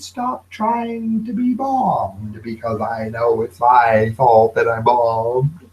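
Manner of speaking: slow, halting comedian voice, odd timbre; Emo Phillips impression. Host doing a comedian bit for viewers, slow halting impression. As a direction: mimic the hesitant comic, slowly. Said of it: "This is my impersonation of Emo Phillips".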